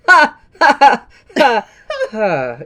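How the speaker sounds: loud staccato ha ha ha; mocking laugh. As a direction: bark out a gloating laugh. Mocking villain laugh at egg bite.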